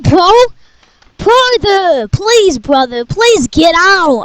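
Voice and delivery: usual speaking voice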